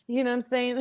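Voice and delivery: ordinary delivery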